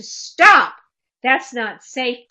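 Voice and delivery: sharp yell settling into a loud, firm order; adult yelling. Shown as a demonstration. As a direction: shout it, then state the warning slowly and firmly. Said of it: "Usually an adult yelling".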